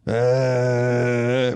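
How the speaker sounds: drawn-out zombie groan. Retold or imitated. Drunk man walking up to the car, zombie groan. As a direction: do a droning undead moan. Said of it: "He's like a zombie".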